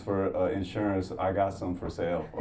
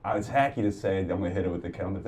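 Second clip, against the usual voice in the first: ordinary talk, nothing marked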